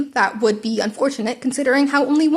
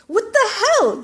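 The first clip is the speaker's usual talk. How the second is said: high startled yell, much higher; 11 semitones higher, 3 dB louder, slower than usual. Acted. Bakugo yells at the gaping hole, shocked and loud. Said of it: "he yelled".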